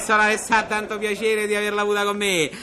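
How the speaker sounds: ordinary delivery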